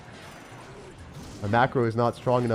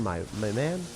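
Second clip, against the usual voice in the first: weird my man; 4 dB quieter than usual. Real, not acted. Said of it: "in the w-, weirdest way possible".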